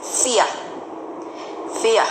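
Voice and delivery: "fear" said fee-uh, the R silent; fear without R. Shown as a demonstration. Teacher modelling the correct vowel, no R. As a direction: end "fear" on a soft uh sound. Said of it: "should not be stressed".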